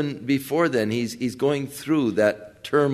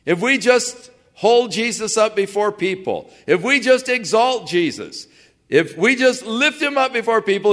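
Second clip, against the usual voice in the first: high, loud mimicry of eager preachers; mistaken ministers; 6 semitones higher, 4 dB louder than usual. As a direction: imitate ministers urging the people, pitched up and easing down toward the end. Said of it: "many ministers and Christians take this term".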